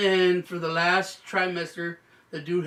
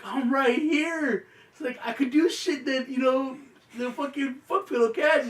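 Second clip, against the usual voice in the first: high, loud mock-wife voice; protesting wife; 8 semitones higher, 5 dB louder than usual. Wife to husband in court, high loud protest. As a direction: imitate an indignant wife, pitched way up.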